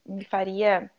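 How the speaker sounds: ordinary talk, nothing marked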